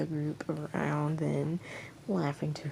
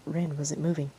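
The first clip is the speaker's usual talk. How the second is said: ordinary delivery, nothing marked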